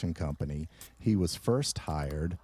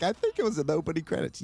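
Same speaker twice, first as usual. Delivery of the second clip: mocking imitation; 9 semitones higher, much faster than usual. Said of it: "You were like".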